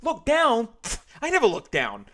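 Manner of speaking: high, loud scoffing retort. Acted. George denying to the cashier, loud scoffing retort. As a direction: scoff and deny it hard. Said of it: "George retorted".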